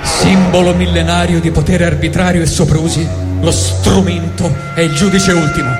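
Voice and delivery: ordinary delivery, nothing marked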